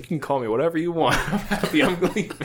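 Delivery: high, quick, laughing surrender; laughing relief. Retold or imitated. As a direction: retell the relief through giggles. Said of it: "I was so relieved".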